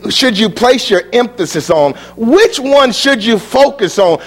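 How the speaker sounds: ordinary talk, nothing marked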